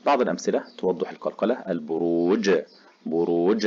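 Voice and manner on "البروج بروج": ordinary talk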